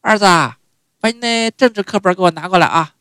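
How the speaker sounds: high, loud mom's call, same as yesterday; mother calling again. Retold or imitated. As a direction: call to her son with a trailing 啊. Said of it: "第二天又一样".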